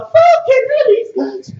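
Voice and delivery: high, loud, slow roar; battle cry. Retold or imitated. Mid-fight at the Wii, slow loud battle roar. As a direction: unleash it like a final punch.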